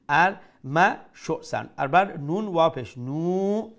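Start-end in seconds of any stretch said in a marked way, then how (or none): 3.0-3.8 নু held on a sustained vowel; one alif long. Shown as a demonstration. Teacher spelling it out for students, long held নু. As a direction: draw out the u of নু. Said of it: "এক আলিফ লম্বা করি".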